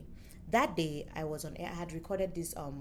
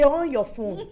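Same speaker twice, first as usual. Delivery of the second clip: loud and high with a laugh; laughing loud insistence; 7 semitones higher, 11 dB louder, slower than usual. Retold or imitated. Colleague pressing the host to check her phone, loud with a laugh.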